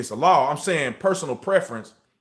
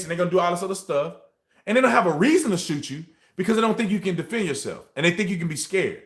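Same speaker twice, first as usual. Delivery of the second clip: ordinary delivery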